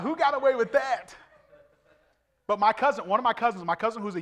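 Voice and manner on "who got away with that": loud, high-pitched incredulous question; comic disbelief. Retold or imitated. Preacher wonders who allowed Smurfs at church, loud incredulity. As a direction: exclaim it in mock astonishment.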